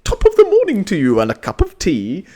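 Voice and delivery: put-on hammy American-tourist Irish accent, high and loud; fake Irish accent. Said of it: "Americans for when they go".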